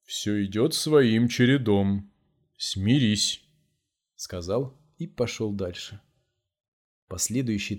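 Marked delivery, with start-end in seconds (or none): 0.0-3.4 брезгливо. A narrator's reading. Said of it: "брезгливо поморщился".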